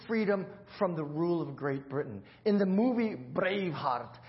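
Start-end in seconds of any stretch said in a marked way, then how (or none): 2.4-4.1 hammed-up Scottish accent, higher and louder. Shown as a demonstration. Pastor shows off his Scottish accent to the church. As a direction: play William Wallace's Scotsman for the congregation. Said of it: "Pretty good, huh?".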